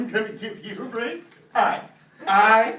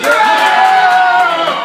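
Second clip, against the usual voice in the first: cheer; 10 semitones higher, 18 dB louder than usual. Real, not acted. Player cheers the win.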